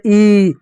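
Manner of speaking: ई stretched out like teasing; bare the teeth on a long "eeee"; long, mocking ई; teeth-baring tease. Shown as a demonstration. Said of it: "दांत दिखा कर लोग चिढ़ाते".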